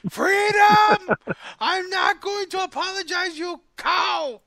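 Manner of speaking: hammed-up, very high Irish-Scottish shout; bad Irish accent. Host clowning as he signs off, badly faked Irish accent. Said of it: "I can't do an Irish accent".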